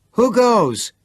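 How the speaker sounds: high, loud call through the door; yelling. A narrator's reading. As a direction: challenge whoever's at the trap door. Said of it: "Chris yelled".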